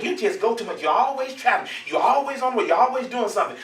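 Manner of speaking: lightly mocked fussing; fussing wife. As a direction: mimic a wife's running complaint. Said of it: "my wife fusses at me".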